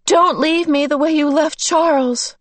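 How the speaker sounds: high, desperate cry to her father; shout. Acted. Meg shouting at her father not to leave her, high and frantic. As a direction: fling it out in terror. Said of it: "Meg shouted at her father".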